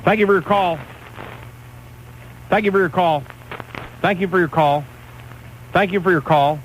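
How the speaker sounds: mock-polite hang-up line, over and over; curt brush-off. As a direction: rattle off a fake-polite goodbye to each caller. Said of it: "I'll just hang up on everybody right now and be rude".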